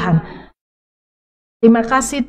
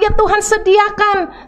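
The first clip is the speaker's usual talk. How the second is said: normal talking voice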